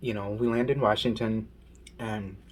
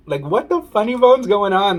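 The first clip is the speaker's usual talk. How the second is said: higher and louder bewildered question; baffled outburst; 8 semitones higher, 6 dB louder than usual. Retold or imitated. Reliving her freaked-out look after landing, higher bewildered. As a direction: throw it out in disbelief.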